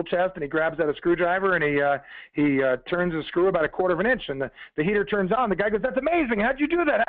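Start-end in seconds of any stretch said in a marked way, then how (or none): 5.8-7.1 high-pitched astonishment, retold; amazed. Customer as the heater turns on, amazed high voice. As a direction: act out wide-open amazement, voice jumping up.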